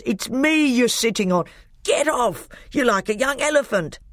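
high, indignant squashed protest; angry voice. A narrator's reading. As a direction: complain crossly from under someone's weight. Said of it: "came an angry voice".

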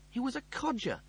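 high, indignant wail; mock horror. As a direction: wail it as if life is over. Lister's inner panic about age.

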